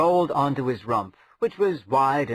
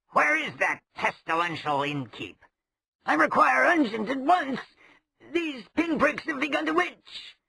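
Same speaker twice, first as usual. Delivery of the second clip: much higher, petulant demands; whiny rogue; 12 semitones higher than usual. Acted. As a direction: fuss like a pampered coward. Chimwazzle demands ointment.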